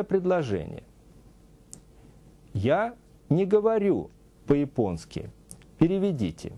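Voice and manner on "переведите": usual speaking voice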